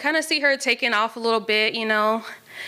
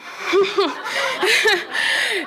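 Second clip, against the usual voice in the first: long bright laugh; high laugh; 12 semitones higher, 3 dB louder than usual. Real, not acted. Laughs after the complaint.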